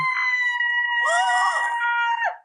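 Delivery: very high, drawn-out gasping squeal; long thin squeal of overwhelmed delight; starstruck squeal. Acted. Fan praised by their idol, long high squeal. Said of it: "I make that noise".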